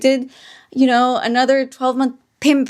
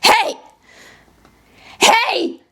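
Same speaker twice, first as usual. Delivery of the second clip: high mock cat yelling; cat demanding; 10 semitones higher than usual. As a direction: yell it like a pushy cat. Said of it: "she's like".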